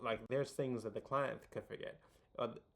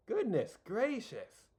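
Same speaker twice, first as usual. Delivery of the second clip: high, slow "goodness gracious"; drawn-out exclamation; 11 semitones higher, 6 dB louder, much slower than usual. Real, not acted. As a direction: stretch it out in mock dismay. Exasperated exclamation at himself.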